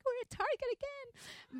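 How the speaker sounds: high, slow, whiny mock voice; whiny complaint. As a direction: whine it like a bored grumpy wife. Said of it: "I would be like".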